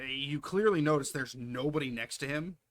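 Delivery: normal talking voice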